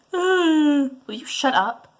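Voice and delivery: exasperated. Acted. Exasperated with mom's fake crying.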